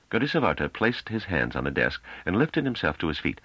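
usual speaking voice

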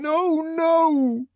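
high, loud repeated cry; sustained high-pitched refusal; desperate protest. A narrator's reading. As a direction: beg him to stop, crying out. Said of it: "still saying".

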